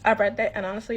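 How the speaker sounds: ordinary talk, nothing marked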